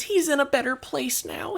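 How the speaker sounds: put-on very high tearful old lady voice; old neighbour consoling. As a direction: console her while hugging. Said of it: "she hugs you back and says".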